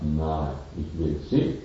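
low, soft voice of Death; a hint of a menacing Death; Death speaking. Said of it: "der Tod guckt sie an und sagt". (leise, tiefe Stimme des Todes zum Milchmädchen)

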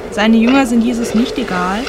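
ordinary talk, nothing marked

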